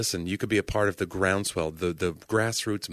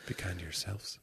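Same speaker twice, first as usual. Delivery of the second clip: NPR voice; 13 dB quieter than usual. Retold or imitated. Soft NPR sign-off continued.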